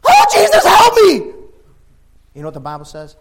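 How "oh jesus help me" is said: hammed-up shrieking plea, piercingly high; screaming like a girl. Sinking Peter crying out to Jesus, girlish mock scream. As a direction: toughest man squealing in sudden terror. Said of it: "he screamed like a girl".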